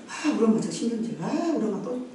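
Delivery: put-on quiet, muttered child grumble; under the breath complaint; sulking kid. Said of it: "막 이런다".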